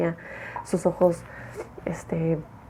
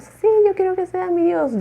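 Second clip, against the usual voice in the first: higher, louder gushing believer; eager follower; 12 semitones higher, 7 dB louder than usual. Retold or imitated. Follower eagerly accepting Jim as God, gushing and high. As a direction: cry out in devoted agreement.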